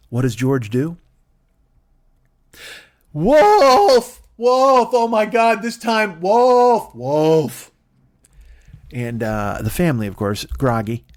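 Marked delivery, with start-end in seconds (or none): 3.1-4.2 loud high alarm cry; frantic shout of wolf. Acted. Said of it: "with a shout of". 4.4-7.7 loud high alarm cry; frantic shout of wolf. Acted. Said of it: "with a shout of".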